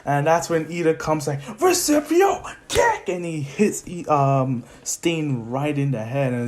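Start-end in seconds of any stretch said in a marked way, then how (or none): 1.6-3.1 very high, drawn-out mock battle cry; anime attack call. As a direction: announce a special move like an anime hero. Anime attack name called out.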